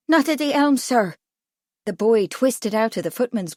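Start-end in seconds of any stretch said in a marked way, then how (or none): 0.1-1.2 put-on higher-pitched boy's voice; earnest Irish boy. A narrator's reading. Irish boy reassuring Kipling, higher and earnest. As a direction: answer up like a respectful lad.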